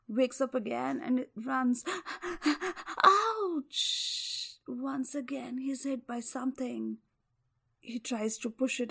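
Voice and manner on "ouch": high, loud cry of pain; high yelp of pain. Acted. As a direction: yelp as you're struck. Hit again in tree.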